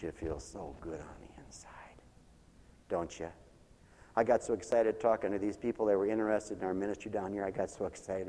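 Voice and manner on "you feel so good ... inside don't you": soft, breathy whisper to the congregation; a sudden secret whisper, much softer. Real, not acted. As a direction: drop to a hushed whisper. Intimate aside to congregation.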